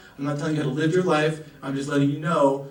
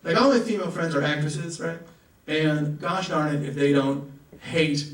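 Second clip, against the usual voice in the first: usual speaking voice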